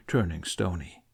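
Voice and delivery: ordinary delivery, nothing marked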